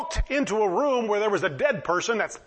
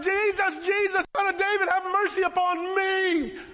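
hollering louder; 9 semitones higher than usual. Retold or imitated. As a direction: holler high, stretching out the final plea. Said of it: "he laughs louder".

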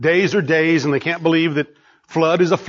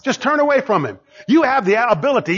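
ordinary delivery

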